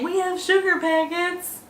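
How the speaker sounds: parroting the line back with a touch of scorn; mock dismissive server. Vlogger mocks the restaurants' excuse, lightly voiced. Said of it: "so stop saying".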